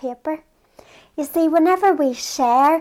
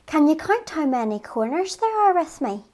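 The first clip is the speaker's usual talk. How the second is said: ordinary talk, nothing marked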